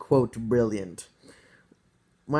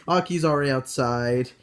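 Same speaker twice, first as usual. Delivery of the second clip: usual speaking voice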